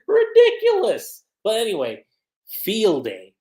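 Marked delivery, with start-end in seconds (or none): 0.1-1.2 high, loud, drawn-out exclamation; mock outrage. Real, not acted. Vlogger mocking his own Naruto bit, high loud cry. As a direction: shriek it, amused and scandalized.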